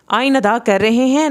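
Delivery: ordinary talk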